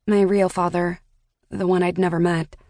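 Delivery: plain reading voice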